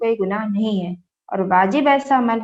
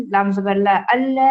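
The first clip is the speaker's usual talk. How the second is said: ordinary talk, nothing marked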